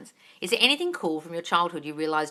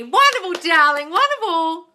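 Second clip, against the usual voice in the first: high, loud, slow gush; gushing approval; 11 semitones higher, 8 dB louder than usual. Real, not acted. Coach applauds a viewer's affirmation, gushing. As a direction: draw out the praise, high and loud.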